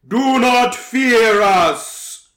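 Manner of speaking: booming, slow royal proclamation. Acted. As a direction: proclaim it like a princess of the night. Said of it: "it is the royal voice".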